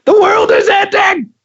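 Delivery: high, loud hammed-up panic; mock panic shout. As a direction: cry out the end of the world. Mock panic over glitching call.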